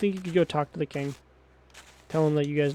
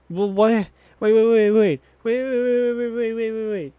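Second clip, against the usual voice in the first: high, rapid-fire run of waits; raised-pitch stammer of disbelief; incredulous interruption; 5 semitones higher, 4 dB louder than usual. Real, not acted. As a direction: cut in with a stream of protests. Interrupting the character's nonsense in disbelief.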